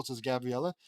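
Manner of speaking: ordinary delivery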